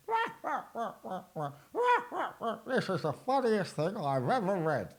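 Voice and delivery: high silly cheering babble; mocking audience voice. Mocking how viewers laugh at his jokes, silly high babble. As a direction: mimic the viewers cheering nonsense. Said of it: "That's your voice".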